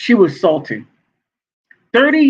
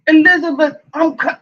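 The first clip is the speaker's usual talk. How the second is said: high, hammed-up pleading; mock crying; 8 semitones higher than usual. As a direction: call out to an ex in fake tears. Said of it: "[name]'s, like, crying".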